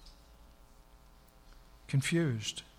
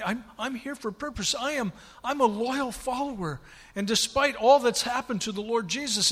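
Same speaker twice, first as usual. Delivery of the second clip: higher, urgent, a loyal follower insisting; Mary begging to find the body, higher and urgent; earnest pleading Mary; 6 semitones higher than usual. Acted. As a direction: plead your case with rising urgency.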